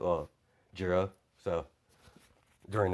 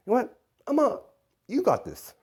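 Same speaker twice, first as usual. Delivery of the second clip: much higher-pitched character voice; breezy handover; 4 dB louder than usual. Acted. Izuku handing over to Void for a nap, breezy high voice. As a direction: pitch it way up, tossing off the decision.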